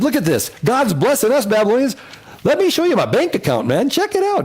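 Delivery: high, bragging show-off voice; a proud king flaunting his gold; boastful king. Retold or imitated. Hezekiah boasting to Babylonians.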